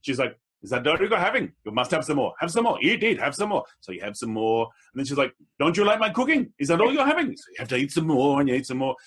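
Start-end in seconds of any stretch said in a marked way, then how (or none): 0.6-3.6 put-on pushy hostess with a Sri Lankan accent. Mother-in-law urging second helpings, Sri Lankan accent. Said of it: "I'll say in that accent". 5.6-7.3 put-on high, affronted Sri Lankan accent. Mother-in-law chiding a guest's appetite, Sri Lankan accent. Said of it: "I'll say in that accent".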